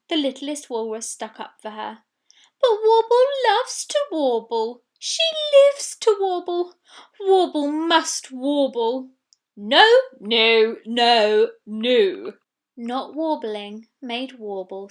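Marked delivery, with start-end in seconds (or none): 2.6-9.1 high, loud earnest voice; little walrus. Acted. Littlest walrus defends Warble, high and loud. 9.6-12.3 loud repeated no; loud refusal. Acted. Huddle refuses the littlest walrus, loud.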